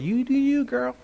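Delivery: high-pitched mock sass; worldly attitude. Preacher imitating how the world talks, mock attitude. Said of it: "with that kind of attitude".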